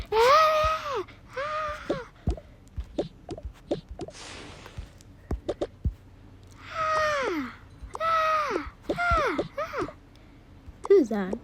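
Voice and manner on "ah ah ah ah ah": high-pitched pretend child's scream; screaming sibling. Siblings shrieking in the background of a pretend group call, screaming. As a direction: shriek as a noisy little sibling. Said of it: "that's my sibling screaming".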